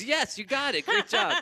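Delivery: high, loud mock celebration; excited congratulations, climbing into laughter. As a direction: imitate an overjoyed AI cheering a win. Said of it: "Like that, like, excited".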